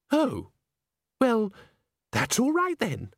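put-on high, airy girlish voice; chirpy relief. A narrator's reading. Christine accepts Agnes's denial, chirpy. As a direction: let it go lightly and brightly.

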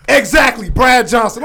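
loud, high vindicated shout; shouting he was right; triumphant shout. Real, not acted. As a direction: yell it in triumph. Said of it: "apologize for my outburst".

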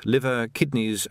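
usual speaking voice

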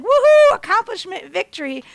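a high, loud woohoo that fades to flat; cheer, then run out of steam; mock victory cheer. Imagining a normal mom celebrating, a mock cheer. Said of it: "a normal mom would be like".

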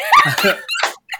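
very high, loud cackling laugh; laughter. Real, not acted. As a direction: burst out laughing at a high pitch. Laughing at thunder joke.

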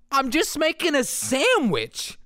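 high, slow, earnest protest; insist, completely earnest and wounded; dead serious. Retold or imitated. Dream self answering a scolding classmate, high and dead serious. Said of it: "dead serious".

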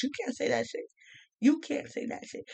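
hushed, far-off mock scold; mock hushed warning. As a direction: whisper it like a scandalized outsider. Others told they can't joke.

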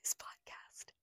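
soft whispered echo. Pretend. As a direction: whisper it back conspiratorially. Whispers it back.

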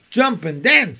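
high, loud excited command; rooster calling out. A narrator's reading. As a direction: crow the order across the yard. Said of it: "said the rooster".

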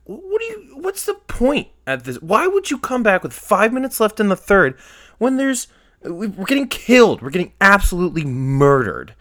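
high-pitched, incredulous retold outburst; exasperated rant. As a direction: rant in rising disbelief, punching key words loudly. Retelling his exasperation at latecomers.

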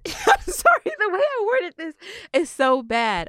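high, laughing through an apology. Real, not acted. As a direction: giggle out the sorry. Laughing at own bad wording.

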